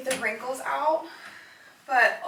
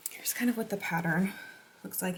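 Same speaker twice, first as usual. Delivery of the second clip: whispering; 8 dB quieter than usual. Real, not acted. Showing pattern up close.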